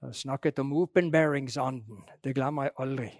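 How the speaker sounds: imitated Stril dialect with an American tinge; Stril dialect, American accent. Imitating a missionary back from Canada, Stril-American accent. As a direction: lightly mimic a Stril speaker's American-accented Norwegian. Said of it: "sånn amerikansk aksent på".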